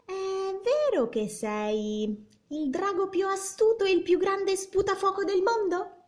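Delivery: put-on high, sweet flattering girl voice; princess. A narrator's reading. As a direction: coax the dragon with flattery. Elizabeth flatters the dragon.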